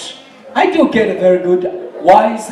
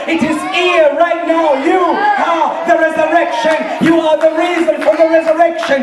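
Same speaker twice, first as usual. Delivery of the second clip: high, fervent pulpit proclamation; full-force preaching; 6 semitones higher than usual. Real, not acted. Preacher proclaiming the resurrection from scripture, full-force. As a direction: proclaim it to the whole congregation.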